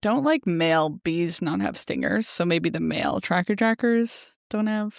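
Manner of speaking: ordinary talk, nothing marked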